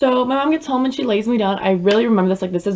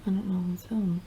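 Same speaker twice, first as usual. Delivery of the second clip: whisper; 12 dB quieter than usual. Real, not acted. Whispered aside.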